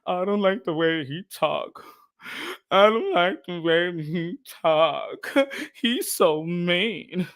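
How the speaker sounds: mocking a whiny complainer, slow and low; whiny beta male. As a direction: drag it out like a sulky critic. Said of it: "Too many beta males out here".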